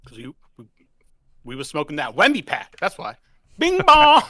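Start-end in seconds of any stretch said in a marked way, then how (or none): 3.6-4.2 loud, high hype call; shouted catchphrase. Real, not acted. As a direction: yell it like a chant. Chanting team catchphrase.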